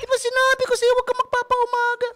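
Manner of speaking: very high, loud scolding wife voice; shrill squeal of a wife scolding a late husband; mock-scolding wife in a piercing high pitch; ultrasonic nagging wife.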